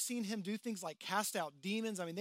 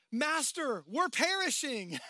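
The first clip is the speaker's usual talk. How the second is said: raised, higher panicked cry of the disciples; disciples yelling in panic; 6 semitones higher, 8 dB louder, slower than usual. Retold or imitated. Preacher voicing disciples yelling in the storm, loud panic. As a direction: call out over the storm in alarm. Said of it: "some of them are just simply yelling".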